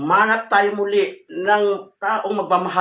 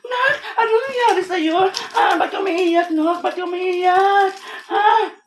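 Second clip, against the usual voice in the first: raised, urgent worried questioning; alarmed mother; 6 semitones higher than usual. Acted. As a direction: rush in frantic over a crying child. Mother finds daughter crying on floor.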